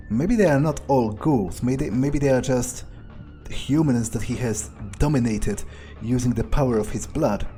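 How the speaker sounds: usual speaking voice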